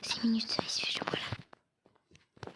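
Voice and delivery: slow hushed aside; whispered to himself. Real, not acted. Gamer muttering to himself about the time, slow whisper. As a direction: murmur it just for yourself.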